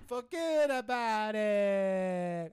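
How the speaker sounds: very slow, teasing Italian-American drawl; exaggerated Italian-American catchphrase. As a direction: stretch it right out to annoy him, Italian-American style. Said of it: "Italian hand gesture at the end".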